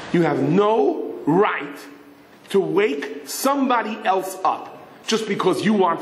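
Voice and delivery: slow, deliberate reprimand; slow scolding. Acted. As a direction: lay it down slowly, word by word. David scolding Yosel.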